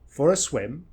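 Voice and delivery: quick "for a", then SWIM long and rising; weak "For a", strong SWIM; stress on SWIM. Shown as a demonstration. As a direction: make "swim" stand out. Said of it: "want that swim to stand out".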